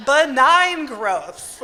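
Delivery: loud, slow, laughing through the words; laughing insistence. Retold or imitated. To the technician, laughing at her own desperate hope, loud and slow. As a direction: push the absurd idea with a laugh.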